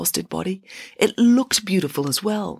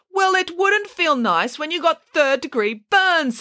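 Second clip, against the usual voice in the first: high, emphatic comeback; scolding retort; 10 semitones higher than usual. A narrator's reading. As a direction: tell the child off in alarm. Hannah retorts to the girl.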